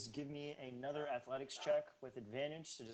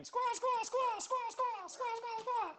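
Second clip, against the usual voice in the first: high squawking bird calls, much higher and louder; bird squawks; 6 dB louder, slower than usual. Acted. Game master voicing birds squawking at the climbers, high squawk. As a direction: screech like caged birds. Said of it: "The birds look at you and start going".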